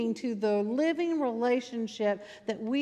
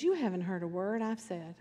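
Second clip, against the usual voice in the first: lower, softer, sobering line; sudden quiet; 4 semitones lower, 6 dB quieter than usual. Retold or imitated. Preacher stops the frenzy to correct his church, quiet. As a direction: drop to a hushed reproof. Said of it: "and it gets real quiet".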